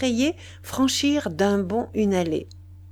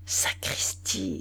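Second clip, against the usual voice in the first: slow whispered oath; slow hushed inner exclamation; private thought; 3 semitones lower, 6 dB quieter, much slower than usual. A narrator's reading. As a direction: whisper it slowly to yourself. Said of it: "j'ai pensé".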